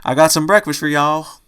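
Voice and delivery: put-on southern accent calling out. A narrator's reading. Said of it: "came a familiar southern accent".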